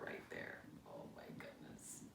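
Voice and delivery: hushed awed whisper. Real, not acted. Reacting to picture for children, whispered. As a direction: breathe it in delight.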